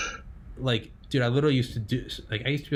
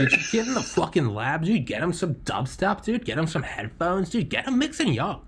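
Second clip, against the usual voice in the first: high, hyped-up dude patter; possessed dude voice; 7 semitones higher than usual. Acted. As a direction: rattle off excited advice in a high put-on bro voice. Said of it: "You got possessed for a moment".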